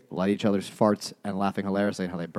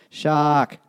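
put-on loud, higher Boston-accented shout; mock Boston accent; 7 semitones higher, 7 dB louder than usual. Quoting an aquarium ad on Boston cabs, Boston accent shout. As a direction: belt it out with a dropped Boston R.

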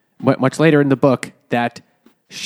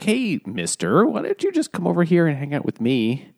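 lightly acted girlish invitation, pitched up; teenage girl; 5 semitones higher than usual. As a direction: put on a teenage girl luring someone over. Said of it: "the teenage girl, is like".

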